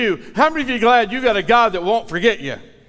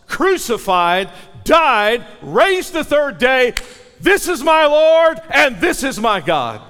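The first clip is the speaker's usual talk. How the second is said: building, louder and higher with each phrase; preaching climax; 7 semitones higher, 5 dB louder than usual. Acted. Preacher building the crowd to a shouted high point. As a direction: preach it to the peak.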